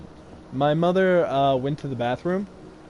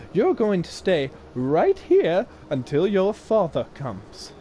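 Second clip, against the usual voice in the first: stern woman; 6 semitones higher than usual. Retold or imitated. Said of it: "she says".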